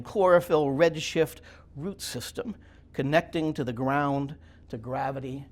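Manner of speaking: slow, measured poem recital with weighted phrases; deliberate, emphatic poet reading aloud; poetry reading. Acted. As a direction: declaim it line by line, pausing between images. Reads own poem aloud.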